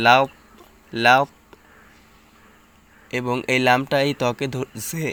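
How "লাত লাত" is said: লাত with a thick, heavy ত; teacher shows লাত said thick and full; heavy lat. Shown as a demonstration. Teacher to students, showing the thick ত in লাত. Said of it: "একটু মোটা হবে".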